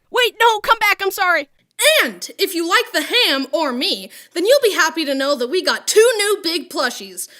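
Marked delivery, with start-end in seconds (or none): 0.1-1.5 higher, louder hurried pleading. Pretend. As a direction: call the crowd back in a panic. Panicked apology after the rant.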